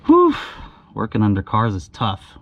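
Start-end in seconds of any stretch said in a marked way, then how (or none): 0.1-0.4 loud high effort exhale; exhausted whew. Real, not acted. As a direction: blow out hard after the strain. Straining under car.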